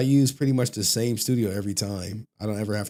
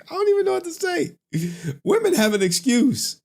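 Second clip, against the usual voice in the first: laughing disbelief; 5 dB louder than usual. Real, not acted. Laughing disbelief at male fans.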